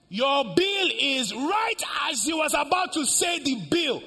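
high, loud storytelling climax; full-force preaching. Acted. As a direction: shout the suspense to the whole congregation, drawing it out.

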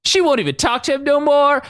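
high, nasal-free squeaky mock Toad. Podcaster mimicking Toad, high mock voice. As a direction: mimic Toad whining about Lance. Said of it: "Toad's like".

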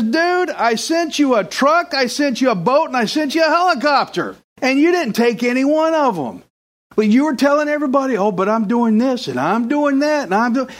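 exasperated God, voiced high; God scolding, high. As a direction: scold him for ignoring the help, high. God answers the drowned man.

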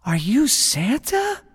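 put-on high, slow child's question; small wondering kid voice; awed little boy. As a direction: ask in wonder, rising at the end. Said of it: "And the boy says".

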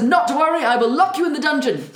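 high, loud hammed-up calm; mock reassuring king. King soothing his wife, hammed-up and loud. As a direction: brush it off like an unbothered king.

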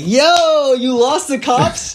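calling out. Retold or imitated. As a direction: shout it up high, drawing out the question. Calling to friend across hill.

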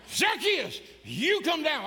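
high, loud scolding yell; mock the stern Sunday school teachers; preacher mocking his angry teachers. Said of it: "they were angry".

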